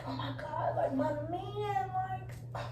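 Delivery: very soft, drawn-out little whine; quiet mock pining. Woman privately pining for her man at work, soft mock whine. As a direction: pine under your breath, stretching it out.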